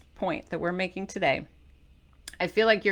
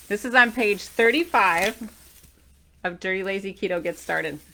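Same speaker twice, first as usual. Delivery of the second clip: normal talking voice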